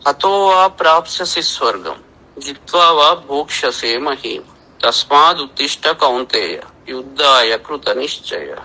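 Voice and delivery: slow, ringing Sanskrit chant; verse recitation. Acted. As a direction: declaim the verse with full weight.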